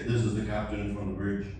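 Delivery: low, self-important captain announcement; arrogant captain. Retold or imitated. Comedian mimicking captain's announcement, arrogant. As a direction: announce yourself grandly in a deep voice. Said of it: "Do you think that's arrogant".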